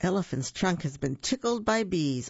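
ordinary delivery

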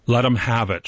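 plain reading voice

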